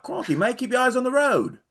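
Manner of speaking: high-pitched mock Aussie driver; Australian accent. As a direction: exclaim it in a put-on Australian accent. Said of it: "discussion in an Australian accent".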